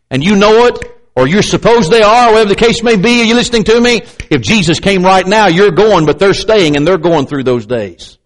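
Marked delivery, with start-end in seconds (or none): none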